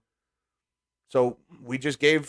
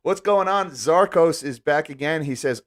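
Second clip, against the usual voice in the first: ordinary delivery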